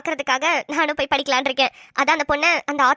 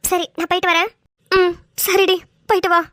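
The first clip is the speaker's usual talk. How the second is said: ordinary delivery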